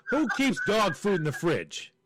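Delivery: worked-up rhetorical question; exasperated disbelief. Real, not acted. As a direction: ask it in comic outrage.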